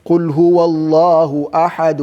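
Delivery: quick chanted Quran recitation; teacher reciting a verse to show the stop. Shown as a demonstration. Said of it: "All right?".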